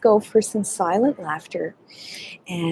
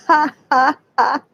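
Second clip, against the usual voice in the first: loud open laughter; hearty laughing; 4 semitones higher, 4 dB louder than usual. Shown as a demonstration. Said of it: "laughing as you go".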